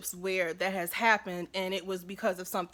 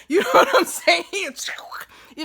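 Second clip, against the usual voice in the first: laughing through the words, high and loud; laughing hard at her own pipe joke; 10 semitones higher, 11 dB louder, much slower than usual. Real, not acted. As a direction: crack up while asking.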